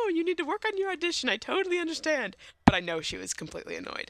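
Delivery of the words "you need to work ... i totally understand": mother voice. Retold or imitated. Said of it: "And she's like".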